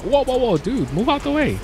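high, sharp call to clear the road; startled shout at a driver. Real, not acted. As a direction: yell at the car in your way. Another car cuts in.

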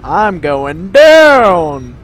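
dragged out. Real, not acted. Player dropping down in game.

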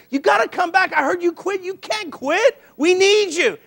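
high-pitched, urgent plea from teammates; pleading teammates. Retold or imitated. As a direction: plead like a kid begging a friend back. Teammates pleading with him to return.

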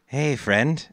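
a hint of a friendly gnome greeting. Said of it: "intercepted by the gnome".

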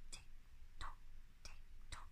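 soft, voiceless, evenly spaced clicks; ticking clock. Acted. Living room clock keeps the bear awake, soft ticking. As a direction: tick quietly like a clock. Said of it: "went the living room clock".

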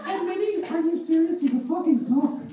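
high cartoon mouse scolding; indignant squeak; Mickey Mouse voice. Mickey scolding Minnie over Goofy, high squeak.

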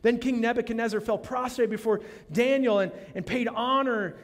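loud, high scripture reading, hammered home; fiery pulpit reading; preaching at full force. Acted. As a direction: proclaim it to the whole hall.

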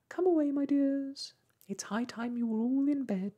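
a nervous little bird calling her chicks; trembling canary. A narrator's reading. The canary calling its children away to bed, high and trembling. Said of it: "called out in a trembling voice".

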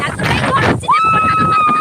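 yelled high over the roaring storm; shouting over wind. Real, not acted. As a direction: shout it out against the gale.

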